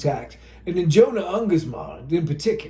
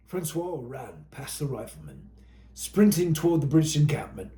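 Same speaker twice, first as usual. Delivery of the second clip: plain reading voice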